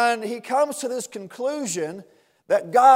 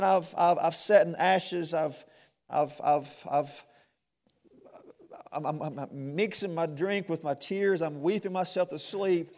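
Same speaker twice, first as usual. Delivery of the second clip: hushed lament; 4 semitones lower, 6 dB quieter than usual. Acted. Voicing the psalmist's weeping.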